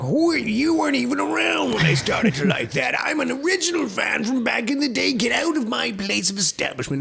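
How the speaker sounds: put-on high, shouted cranky old-timer voice; hammed-up crotchety fan shooing newcomers away. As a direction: yell like a gatekeeping old fan. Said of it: "I'm old and crotchety".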